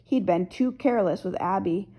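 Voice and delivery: ordinary delivery, nothing marked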